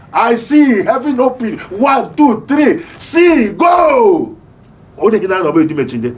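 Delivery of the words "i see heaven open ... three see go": high, slow, building into a shout; preaching climax. Acted. Preacher rousing the congregation, rising to a shout. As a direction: ramp up to a proclaiming cry.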